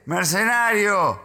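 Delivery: high-pitched yelled string of insults; retold shouting. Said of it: "me grita".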